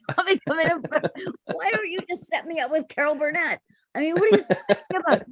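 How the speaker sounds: fast high mock panic, laughing through it; high-pitched frantic rant at the director; panicked disbelief. Mocking panic retold.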